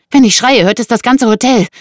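high, loud warning; alarmed threat. Acted. Woman threatens to scream the hotel down, alarmed.

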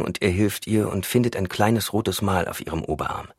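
ordinary delivery, nothing marked

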